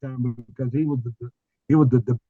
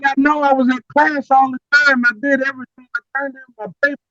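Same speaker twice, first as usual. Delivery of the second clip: higher, louder, a retold angry objection; indignant protest; 9 semitones higher, 4 dB louder than usual. Student protesting his incomplete grade, indignant and raised. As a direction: protest your innocence, worked up and high. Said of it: "and I was mad".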